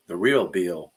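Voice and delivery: a playful touch of a Southern accent on "real Bill"; little Southern accent. Shown as a demonstration. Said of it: "I said that with a little Southern accent".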